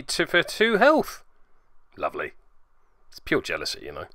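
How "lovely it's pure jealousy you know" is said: dry sarcasm. Acted. Dry sarcasm at enemy healing.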